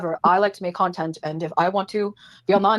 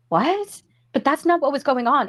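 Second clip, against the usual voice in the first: surprised; 7 semitones higher than usual. Retold or imitated. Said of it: "they were like".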